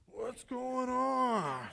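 high, very slow playing-dumb question; fake innocence. Boy playing innocent in front of mom, fake innocence. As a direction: act like you know nothing.

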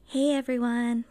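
ordinary talk, nothing marked

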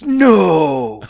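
sharp astonished cry of no. Retold or imitated. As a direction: gasp it out in shock. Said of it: "in total astonishment goes".

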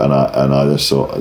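usual speaking voice

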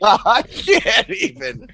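high, slow words through laughter; cracking up, can barely speak; laughing. Real, not acted. Laughing at the question.

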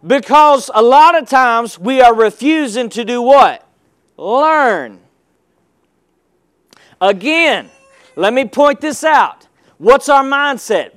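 ordinary talk, nothing marked

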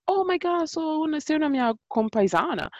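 high-pitched excited switch into Italian; delighted discovery. Retold or imitated. Italian woman finding a fellow countrywoman, delighted. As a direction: imitate a thrilled compatriot.

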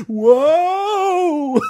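high loud laughing whoa; high laughing whoop at the joke. Real, not acted. Laughing mock exclamation.

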